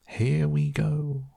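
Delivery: high, drawn-out whoop; excited cry. A narrator's reading. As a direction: stretch it out high like a thrill-ride cheer. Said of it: "shouted Grandpa Joe again".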